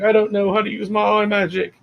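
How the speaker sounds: high mock-kid protest; kid's whiny reply. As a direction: whine it like a clueless kid. Voicing Heimdall's son replying.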